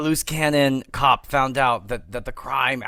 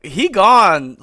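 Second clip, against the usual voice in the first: mock shout; 3 semitones higher, 7 dB louder than usual. Council members answering Ned.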